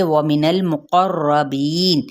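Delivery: व मिनल मुक़र्रबीन slowly, with a heavy ra; slow chanted मुक़र्रबीन; tajweed recitation. Shown as a demonstration. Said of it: "वाव होंठों की ना तमाम गुलाई के साथ".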